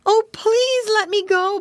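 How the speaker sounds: high, slow, loud pleading; begging. A narrator's reading. Piggy boy to the alligator, high drawn-out begging. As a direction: plead like a captured little piglet. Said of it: "begged Curly".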